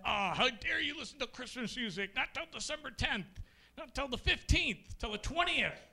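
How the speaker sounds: high, huffy scold of a Christmas music hater; indignant scold. Retold or imitated. Comic mimicking a prissy anti-Christmas-music person, indignant. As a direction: scold like an offended purist. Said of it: "That was my impression".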